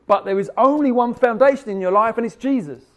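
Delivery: loud, high proclamation; forceful preaching. Real, not acted. Preacher climaxing on Jesus.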